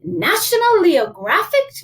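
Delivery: high, loud storybook mother; musing mama. Acted. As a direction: read out the title in disapproval. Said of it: "mused Mama".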